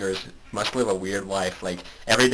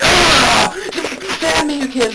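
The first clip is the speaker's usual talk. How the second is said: very high, loud sudden shriek; mock crazed scream; 9 dB louder, slower than usual. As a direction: scare the kids with a hammed-up yell. Acts out sudden scream at grandchildren.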